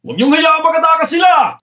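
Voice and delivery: very high, frantic yell; urgent shout. Acted. Pursuer orders others to stop the escapees, urgent shout. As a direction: call out the chase.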